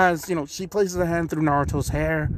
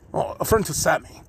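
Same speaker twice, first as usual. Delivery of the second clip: ordinary talk, nothing marked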